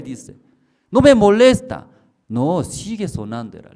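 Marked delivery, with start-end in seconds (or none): 0.9-1.9 high, loud whiny complaint; woman woken at night, high and loud; annoyed sleeper. Retold or imitated. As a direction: push the voice away, half asleep.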